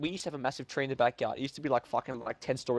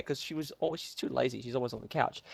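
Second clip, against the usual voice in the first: usual speaking voice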